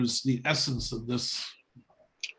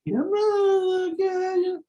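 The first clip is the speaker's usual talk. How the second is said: high, drawn-out coaxing call; dad coaxing cat; much slower than usual. Retold or imitated. Dad calling the cat under the shed, high sing-song. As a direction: call the cat sweetly from outside. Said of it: "He goes like this".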